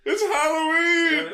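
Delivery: shouting it out high; excited shout. Real, not acted. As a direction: yell it with glee. Shouted Halloween greeting.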